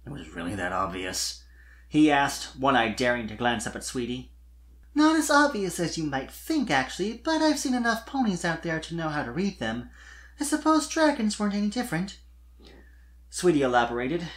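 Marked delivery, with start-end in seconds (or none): 0.0-1.4 low, soft, deflated dragon voice; deflated sigh. Acted. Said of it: "he sighed ... deflated balloon". 4.9-12.2 much higher giggly filly voice; Sweetie Belle voiced.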